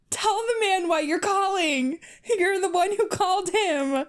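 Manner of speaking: high squeal of mock exasperation; high-pitched comic outburst. Reader breaks into high mock outburst.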